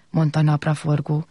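ordinary delivery

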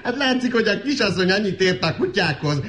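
high chuckle leading into the words; amused scoffing. Acted. Servant scoffs at the young lady's ideas about dogs, amused. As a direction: laugh as you start to answer.

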